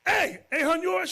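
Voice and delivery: high, loud call to the wife; husband calling to his wife on coming home. Retold or imitated. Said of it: "husband calls to wife".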